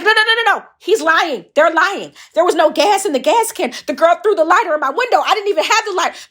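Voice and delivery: very high, loud panicked pleading. Retold or imitated. To the cop, high panicked pleading. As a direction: beg frantically, near tears. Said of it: "I got soft. I'm s-, crying".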